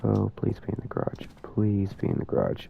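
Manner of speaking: soft, nervous plea under the breath; hushed pleading. Real, not acted. Player hoping the ghost is in the garage, hushed pleading. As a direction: beg quietly, as if to yourself.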